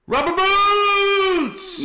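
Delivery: high, long drawn-out call; mock far-off yell in a high voice; imitated fan's holler. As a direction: holler it down the beach, stretching it out. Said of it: "all I hear is".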